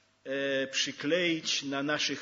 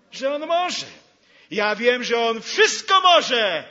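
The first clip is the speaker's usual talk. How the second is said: fervent declaration; 6 semitones higher, 10 dB louder than usual. Acted. Preacher voicing confident self-talk.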